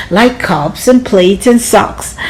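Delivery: ordinary delivery, nothing marked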